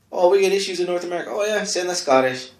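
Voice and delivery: hammed-up imperial planners talking quickly; mock officials. British officials scheming over North America, loud fast mock voices.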